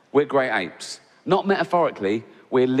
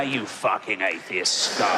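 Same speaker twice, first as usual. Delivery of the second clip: lower, slow sneering devil; Satan sneering; 6 semitones lower, slower than usual. Retold or imitated. Satan taunting the atheist in hell, low slow sneer. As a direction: growl the insult down low like Satan. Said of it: "he's just there".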